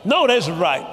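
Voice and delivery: normal talking voice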